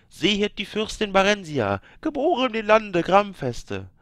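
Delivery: high, loud ceremonial chant of a priest; lifted, ringing liturgical call to the gods; solemn priest's proclamation. A narrator's reading. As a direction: intone it like a high priest blessing a child.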